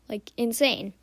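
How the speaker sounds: normal talking voice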